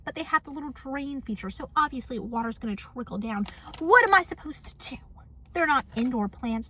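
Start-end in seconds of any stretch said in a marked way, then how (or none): 3.8-5.0 high, loud protest; exasperated plea. Acted. Vlogger asking the neighbor about watering plants, exasperated plea. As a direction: demand an answer in frustration.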